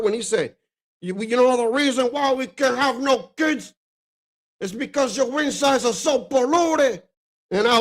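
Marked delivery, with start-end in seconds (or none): 1.0-3.7 put-on Cuban accent. Quoting the movie to friends, mock Cuban accent. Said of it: "with the accent". 4.6-7.0 put-on raised Cuban gangster accent. Retelling the movie, mock Cuban accent. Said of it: "with the accent".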